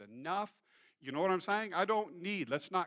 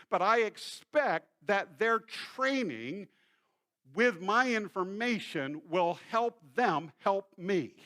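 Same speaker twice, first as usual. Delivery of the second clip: building emphasis; 4 semitones higher, 6 dB louder than usual. Real, not acted. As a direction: lean into each word. Preacher builds to emphatic point.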